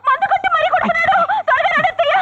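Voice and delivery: high-pitched wail of complaint; shrieked tattling on a husband; crying out. Acted. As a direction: yell it so the in-laws hear. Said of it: "దొంగని పట్టుకుని అరుస్తుంది".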